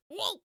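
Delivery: high yelped whoa; meme whoa. Retold or imitated. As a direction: yelp it high. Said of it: "responsible for that meme where he's like".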